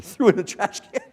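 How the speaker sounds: normal talking voice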